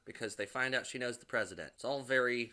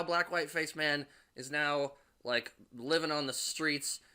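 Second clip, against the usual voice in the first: normal talking voice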